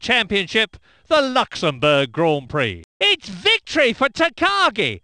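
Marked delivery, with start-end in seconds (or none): 3.0-5.0 higher, triumphant call; excited win call. Acted. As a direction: celebrate the winner. Commentator calls race win.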